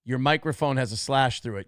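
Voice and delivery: usual speaking voice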